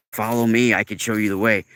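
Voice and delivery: ordinary talk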